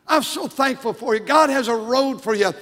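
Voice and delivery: ordinary talk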